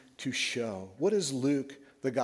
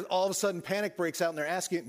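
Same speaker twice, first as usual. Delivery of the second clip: ordinary talk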